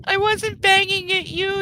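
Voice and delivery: high, drawn-out gentle reassurance; slow it down and soothe. Real, not acted. Repeated, slower to puppy.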